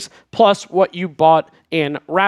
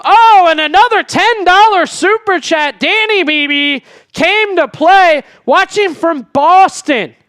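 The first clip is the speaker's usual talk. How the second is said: louder and higher, slowed right down, hyping the donation; excited shout-out; 7 semitones higher, 4 dB louder than usual. Real, not acted. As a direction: call out the big super chat with real excitement. Hyped reading of a ten dollar super chat.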